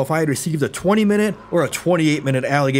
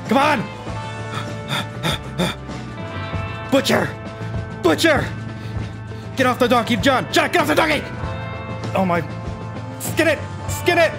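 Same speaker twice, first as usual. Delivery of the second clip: high, urgent shouting at the screen; frantic yelling; 8 semitones higher than usual. Real, not acted. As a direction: yell commands at John in a panic. Yelling at game character urgently.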